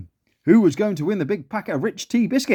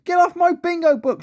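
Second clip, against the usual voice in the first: high, outraged yell; high-pitched indignant shout; 14 semitones higher, 4 dB louder than usual. A narrator's reading. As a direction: screech at the intruder to clear off. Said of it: "she yelled".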